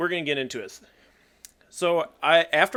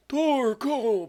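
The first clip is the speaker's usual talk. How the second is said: higher, drawn-out mock cry; playful name call; 9 semitones higher than usual. Player theatrically naming a Pokemon option, playful name call. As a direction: call it out high in a put-on voice.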